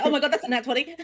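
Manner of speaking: laughing, high, loud mock excitement; imitating self-interruption. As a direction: playfully mimic a sudden excited outburst. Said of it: "I like the way you interrupted yourself".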